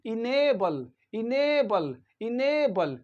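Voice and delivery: enable with a long, stressed A, "i-NAY-bul"; careful teacher's enable, middle syllable stretched. Shown as a demonstration. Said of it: "ये long ए है यहाँ पर".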